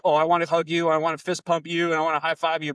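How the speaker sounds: bubbling over inside, a touch hammed-up; inner excitement. Coach to client after a lift, mock gushing. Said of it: "'Cause inside I'm like".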